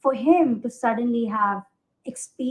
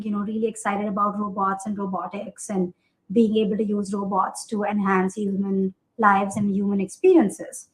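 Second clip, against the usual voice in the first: ordinary talk, nothing marked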